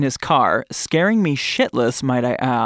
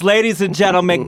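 brisk, louder showman call; excited emcee; 5 semitones higher, 4 dB louder, faster than usual. Acted. Host announcing her guest with a showman's build. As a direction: hype the crowd for the guest.

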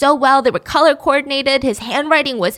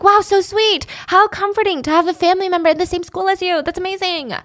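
sarcastic high gush; 7 semitones higher than usual. Retold or imitated. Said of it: "You're like".

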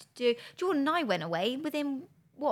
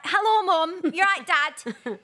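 put-on higher, louder Mancunian kid voice; Mancunian accent; 7 semitones higher, 6 dB louder, slower than usual. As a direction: greet mum and dad in Manchester accent. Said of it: "in a Mancunian accent".

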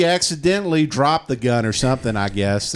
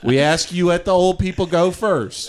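higher, lightly voiced church leader; hint of a preacher herding the flock; mock pastor announcement; 6 semitones higher than usual. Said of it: "they go".

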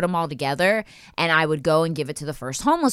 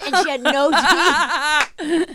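ordinary talk, nothing marked

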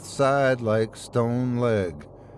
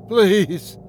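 desperate choked plea; 7 semitones higher, 5 dB louder than usual. Acted. Being strangled, begging.

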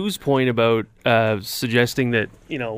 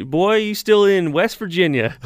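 put-on Southern accent; 8 semitones higher, 3 dB louder than usual. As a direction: imitate a Southern tollbooth woman. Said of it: "her southern accent".

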